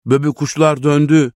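high, moderately loud call of glad news; joyful shout. A narrator's reading. Tahir calling to Böbü as he dances, joyful shout. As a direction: call out excitedly to someone far away. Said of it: "diye bağırıyordu".

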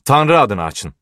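urgent shout for entry; shouted demand. A narrator's reading. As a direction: call out insistently from outside a door. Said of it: "diye bağırdı biri".

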